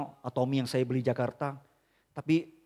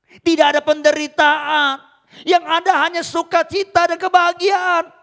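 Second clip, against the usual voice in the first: higher and louder, a booming divine promise; voice of God; 12 dB louder than usual. Acted. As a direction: proclaim as God comforting his servant. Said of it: "Tuhan tuh ngomong gini". (suara Tuhan yang lantang menghibur)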